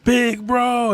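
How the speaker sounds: very high, loud anguished yell. Retold or imitated. Brook yells over his brother's body, anguished scream. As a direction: scream his name through tears. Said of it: "he yells".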